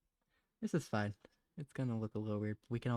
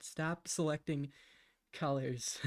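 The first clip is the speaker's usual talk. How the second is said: usual speaking voice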